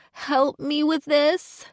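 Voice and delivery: high, slow pitiful whine; whiny plea. Pretend. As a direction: whine it, fake-helpless. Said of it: "I whine in my best sorry voice".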